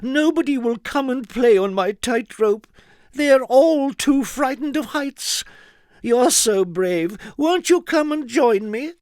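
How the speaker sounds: put-on high, loud small girl's voice, coaxing; little girl pleading. A narrator's reading. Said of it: "little Miss Somersault pleading".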